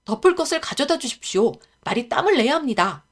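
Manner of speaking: put-on a boy pressing the grown-ups; urgent stable boy. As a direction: ask for a blanket with urgency. Character voice in read-aloud tale.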